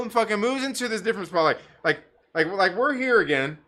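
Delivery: ordinary talk, nothing marked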